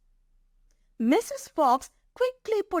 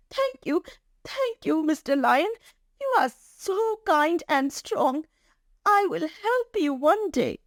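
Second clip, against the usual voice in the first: high-pitched, humble mouse voice; grateful little mouse; 6 semitones higher than usual. Acted. Mouse thanking the lion for sparing it, grateful.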